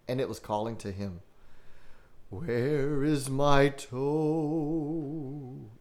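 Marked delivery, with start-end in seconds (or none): 2.3-5.7 very slow, drawn-out moaning voice; groaning ghost. Acted. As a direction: moan it like a corpse calling for its toe. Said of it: "it groaned".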